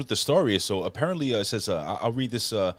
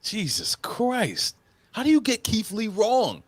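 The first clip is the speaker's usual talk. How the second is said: exasperated disbelief; 7 semitones higher than usual. Real, not acted. Outraged at booking decision.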